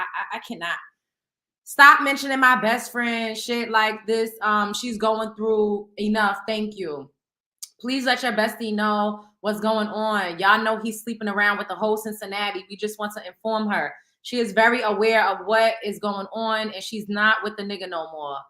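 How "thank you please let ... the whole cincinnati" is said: ordinary talk, nothing marked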